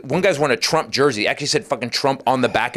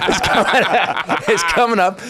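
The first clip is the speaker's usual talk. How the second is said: higher, louder, through laughter; laughing mock announcement; 5 semitones higher, 3 dB louder than usual. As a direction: laugh your way through the repeat. Mocking his overlong closer, laughing.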